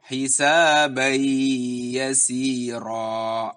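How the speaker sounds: tanwin merged into a held nasal y; gunnah on hisabai yasira. Shown as a demonstration. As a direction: recite hisabai yasira slowly, linking the words. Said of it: "sedikit ditahan".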